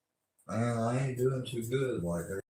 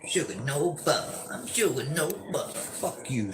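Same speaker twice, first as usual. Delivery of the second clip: high, sneering mock of Sugar Nova; mocking high imitation; 7 semitones higher than usual. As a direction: parody her in a higher voice.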